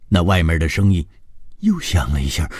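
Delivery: ordinary delivery, nothing marked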